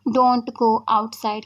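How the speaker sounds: very slow, clear drill of the sentence; slow model sentence. Shown as a demonstration. Teacher to students, slowly modelling the sentence. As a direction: say it word by word for learners. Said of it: "आप बोल रहे हैं ये sentence".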